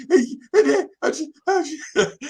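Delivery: high, loud crying attempt to speak; sobbing kid. Crying boy trying to explain to teachers, sobbing. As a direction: try to talk through sobs. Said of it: "I'm trying to tell them".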